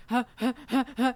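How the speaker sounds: quick mock gasps for air; imitating gasping. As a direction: pant out short fake gasps. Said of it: "like, gasping for air".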